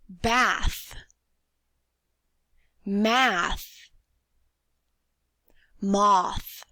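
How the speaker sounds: slow, drawn-out th at the end of Bath, Math, Moth; model words for repeating. Shown as a demonstration. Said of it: "Repeat them after me".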